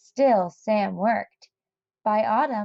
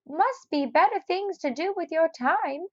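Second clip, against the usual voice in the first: put-on higher, grumbling old man; old neighbour scolding; 8 semitones higher than usual. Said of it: "he told Sam".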